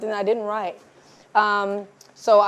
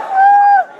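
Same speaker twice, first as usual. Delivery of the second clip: very high, loud fangirl squeal; mock starstruck scream; 10 dB louder than usual. Fan walking in on famous writer, starstruck squeal. Imitates a fan seeing Issa Rae.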